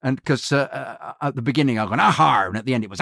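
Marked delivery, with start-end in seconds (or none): 2.0-2.4 higher-pitched piratical aha; hearty pirate's exclamation; pirate cry. Shown as a demonstration. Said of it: "I think they're too piratical".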